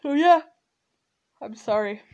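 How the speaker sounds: talking through a yawn. Real, not acted. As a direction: apologize mid-yawn. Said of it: "I'm yawning again".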